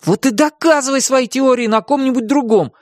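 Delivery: put-on high-pitched boy blurting it out; heated boyish retort, high; blurted retort. A narrator's reading. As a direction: fire back as an annoyed boy. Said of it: "выпалил Тим".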